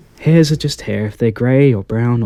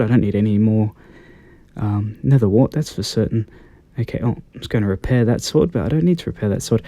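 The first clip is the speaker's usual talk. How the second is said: usual speaking voice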